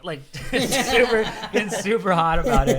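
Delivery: high, loud words through laughter; real laughing. Real, not acted. Teller describing Mikey's temper to friends, laughing. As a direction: crack up while talking. Teller cracks up at his story.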